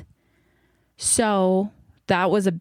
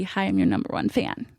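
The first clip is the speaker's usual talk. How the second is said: much lower, put-on voice of a gushing fan; eager superfan; 11 semitones lower than usual. Host greeting her guest, playing a deep-voiced superfan. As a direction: drop the voice low and play a devoted number one fan. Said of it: "let me jump in and just be".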